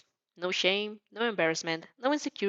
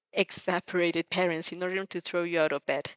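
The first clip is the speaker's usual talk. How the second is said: ordinary delivery, nothing marked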